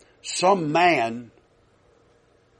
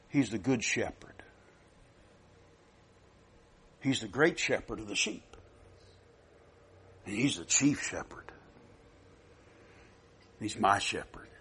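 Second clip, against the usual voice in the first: hushed reverence; 4 semitones lower, 11 dB quieter than usual. Acted. Reverent hush after loud litany.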